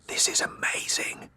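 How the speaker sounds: breathless whispered delight. Acted. Wife whispering to husband during the show, awed whisper. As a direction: whisper it to your husband in the dark hall. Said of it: "she whispers".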